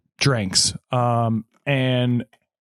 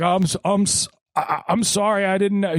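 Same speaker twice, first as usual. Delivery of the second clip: higher-pitched, stumbling apology; mock flustered stammer; 6 semitones higher than usual. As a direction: imitate a man caught out, hemming and hawing. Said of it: "he's a humming".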